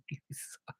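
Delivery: laughing whisper. Real, not acted. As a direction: breathe it out laughing. Laughing whispered jab at partner.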